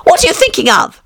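high severe scolding; stern mouse. A narrator's reading. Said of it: "said the mouse to Alice severely".

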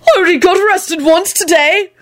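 very high gleeful squeal; joyful scream. A narrator's reading. Telling his mate of an earlier arrest, joyful. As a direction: squeal it like a delighted boast. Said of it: "Neville screamed joyfully".

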